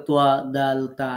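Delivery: "তোয়া, দাল, তা" pronounced firmly; firm articulation. Shown as a demonstration. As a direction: press firmly on each letter of "তোয়া, দাল, তা". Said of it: "শক্ত করে উচ্চারণ করতে হয়". ("তোয়া, দাল, তা" শক্ত করে উচ্চারণ)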